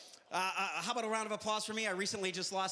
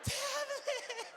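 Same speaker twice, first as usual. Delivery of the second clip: put-on high shouted kid demand; mock three-year-old screaming for a tablet; toddler tantrum; 9 semitones higher, much slower than usual. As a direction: yell for the tablet like a desperate child.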